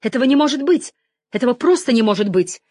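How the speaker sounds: high, loud cry of disbelief; anguished denial. A narrator's reading. Sister cannot accept her brother's death, crying out. Grieving sister refuses the news.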